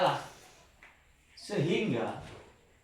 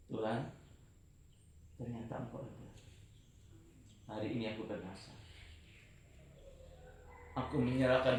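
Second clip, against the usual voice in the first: soft, broken prayer on his knees; tearful vow; 3 semitones lower, 4 dB quieter than usual. Retold or imitated. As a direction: pray it quietly through tears. Kneeling, crying, vowing to God. (doa lirih sambil menangis)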